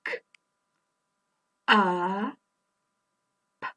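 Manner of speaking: K a p one sound at a time; slow phonics segmenting; sounding out slowly. Shown as a demonstration. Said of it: "Let's say cup slowly".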